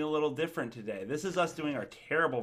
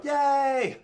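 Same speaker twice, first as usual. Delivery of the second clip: excited cheer; 11 semitones higher, 6 dB louder than usual. Real, not acted. Cheering after not doing intro.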